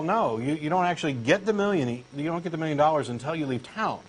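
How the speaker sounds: ordinary talk, nothing marked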